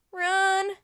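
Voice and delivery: high, loud call to flee; urgent cry. A narrator's reading. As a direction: cry out a warning. Annie urges escape from soldiers.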